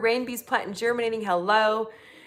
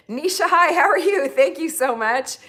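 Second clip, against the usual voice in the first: excited greeting; 6 semitones higher, 5 dB louder than usual. Real, not acted. Greeting a gift giver.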